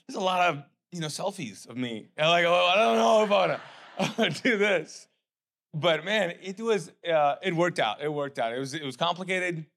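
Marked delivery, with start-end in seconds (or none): 2.4-5.1 nervous laugh breaking into the words; anxious laugh. Retold or imitated. Telling the church about the big screen, nervous laughing. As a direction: laugh off the nerves, higher and louder. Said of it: "anxiety situation".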